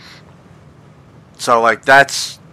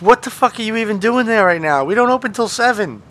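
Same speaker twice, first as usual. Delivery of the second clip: higher, incredulous mock outrage; exasperated retort; 10 semitones higher than usual. Retelling his reply to the early caller, exasperated. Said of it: "And I'm like".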